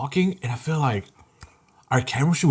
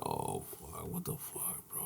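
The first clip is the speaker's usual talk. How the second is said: barely audible muttered cursing; whispered, deflated self-talk; retold whispered dismay; 9 semitones lower, 24 dB quieter, slower than usual. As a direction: curse to yourself under your breath. Retold private dismay at rejection.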